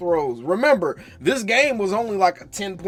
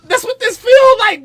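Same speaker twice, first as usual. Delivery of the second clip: loud, high outburst; exasperated shout; 14 semitones higher, 9 dB louder than usual. Real, not acted. Fan venting to viewers, exasperated shout. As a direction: shout the point home.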